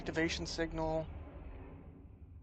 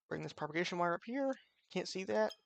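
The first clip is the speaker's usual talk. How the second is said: ordinary talk, nothing marked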